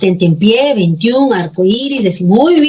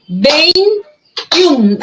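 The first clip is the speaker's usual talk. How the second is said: "Veintiún" as two held syllables, "vein", pause, "tiún"; split into syllables; 4 semitones higher than usual. Shown as a demonstration. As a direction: count out "Veintiún" in two beats, higher pitched. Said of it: "Hay dos sílabas". ("Veintiún" en dos sílabas, con pausa entre "vein" y "tiún")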